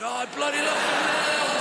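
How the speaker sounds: hammed-up craving, high and eager; a desperate fan gushing over his drink; mock craving. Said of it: "You're like".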